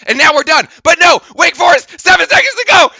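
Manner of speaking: frantic final call. Real, not acted. Commentator in the final seconds, shouting. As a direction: build to a panicked yell.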